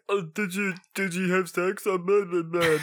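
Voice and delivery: a light sneering mock of the guys. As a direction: parody the eager guys. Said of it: "the guys being like".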